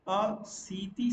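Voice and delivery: "असीति" very slowly, "a... see-tee"; slow dictation of "असीति" for learners; drawn-out model word. Shown as a demonstration. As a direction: stretch "असीति" syllable by syllable. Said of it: "अस्सी को बोलते हैं".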